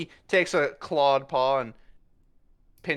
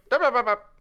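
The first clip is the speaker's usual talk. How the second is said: high, louder rapid shushing dups; hushing cut-off; 11 semitones higher, 5 dB louder, faster than usual. Acted. Puss hushing Zenith about Tabaxi shedding. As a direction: shut the topic down fast and high.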